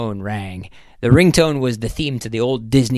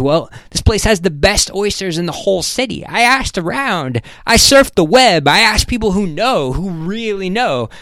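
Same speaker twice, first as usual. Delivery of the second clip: higher, emphatic coaxing, building as it goes; insistent businessman; 7 semitones higher, 4 dB louder than usual. Acted. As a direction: plead your case like a pushy host. Voices Ham coaxing his guest.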